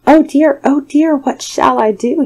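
high, loud panicked wail; frightened high voice; alarmed cry. A narrator's reading. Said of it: "he cried".

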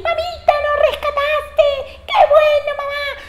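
very high, joyful squeals; rescued little goats. Acted. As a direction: cheer like relieved small kids. Rescued kids cheer.

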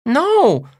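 put-on high, insistent small boy; a loud little kid contradicting Mom; little brother. A narrator's reading. Little brother voiced in story.

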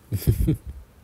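sudden loud laugh breaking the hush; burst of laughter. Real, not acted. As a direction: let a real laugh burst out.

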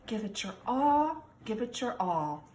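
ordinary delivery, nothing marked

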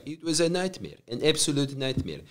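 put-on soft Croatian-accented lament; Croatian dad impression. Dad recalls his nightmare job, soft Croatian accent. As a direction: imitate a dad's quiet complaint, Croatian accent. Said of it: "he was like".

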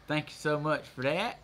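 ordinary talk

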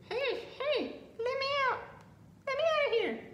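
high-pitched little pancake voice; tiny high voice pleading from inside the oven; trapped pancake calling out. A narrator's reading. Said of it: "she heard a muffled sound in her kitchen".

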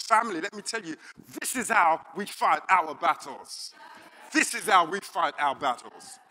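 loud, emphatic preacher's declaration; rally the congregation, loud; preacher rousing the family, loud and emphatic; preaching at full force. Acted.

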